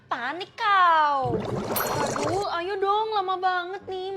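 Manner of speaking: slow, loud drawn-out jeer; loud, slow sneering tease; mocking taunt. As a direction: rub it in, savour each word. Rival taunts the girl.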